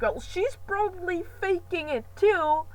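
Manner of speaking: put-on high, loud protesting kid voice; indignant child. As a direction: demand it like an outraged little kid. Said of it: "And I was like".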